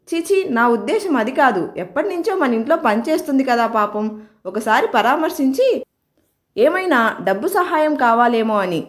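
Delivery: ordinary delivery, nothing marked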